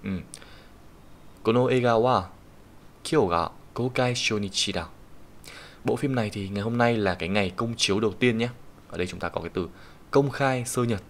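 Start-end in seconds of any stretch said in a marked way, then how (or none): 1.4-4.9 slow, careful sentence reading for students; reading example sentence. Shown as a demonstration. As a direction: read it out slowly like a model sentence. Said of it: "reads example sentence aloud".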